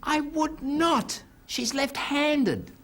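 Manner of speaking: very high, slow punchline; high slow deadpan ending. Acted. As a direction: drag out the pay-off. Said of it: "He said".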